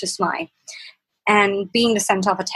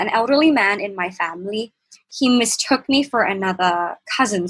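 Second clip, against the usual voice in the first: ordinary talk, nothing marked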